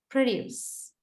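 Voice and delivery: "produce" with a heavy first syllable, falling away; noun stress on first syllable. Shown as a demonstration. Teacher demonstrates the noun meaning, first-syllable stress on "produce". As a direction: hit PRO in "produce", ease off after. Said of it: "much more heavier than the second part".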